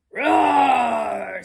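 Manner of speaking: loud roaring growl; loud polar bear roar. Acted. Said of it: "said the hill".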